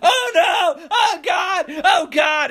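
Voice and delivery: high, loud wails; imitated screaming. Woman wailing over drowned dog, high mock shrieks. Retold cries at dead dog.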